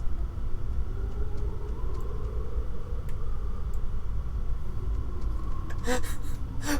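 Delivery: high wheezing laugh into tears; teary breathless giggle fit; crying laughter. Real, not acted. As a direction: laugh till it hurts.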